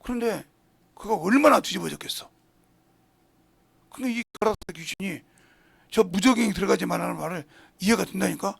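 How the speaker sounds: ordinary talk, nothing marked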